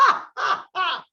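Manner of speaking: booming giant's laugh; loud guffaw. Acted. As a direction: laugh big like a giant mocking. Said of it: "avec son grand rire impressionnant".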